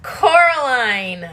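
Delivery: mock exasperation. Real, not acted. Exasperated at cat.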